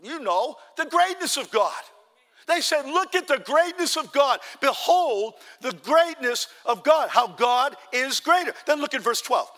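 high, loud pulpit exclaiming; full-force preaching. Real, not acted. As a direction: drive the point home to the whole church.

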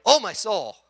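loud alarmed "Oh, my soul". Retold or imitated. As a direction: cry out "Oh, my soul" like a shocked wife. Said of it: "Okay, you know".

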